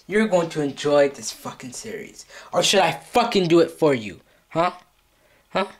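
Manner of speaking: usual speaking voice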